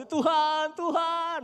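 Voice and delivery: loud anguished calling out; desperate cry to God. Retold or imitated. Preacher voices believers suddenly crying to God, loud and desperate. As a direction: cry out as trouble hits.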